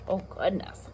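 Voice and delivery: ordinary delivery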